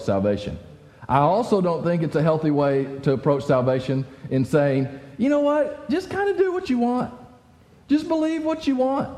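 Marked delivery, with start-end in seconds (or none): 5.2-9.1 mock careless voice. Mocking careless view of salvation.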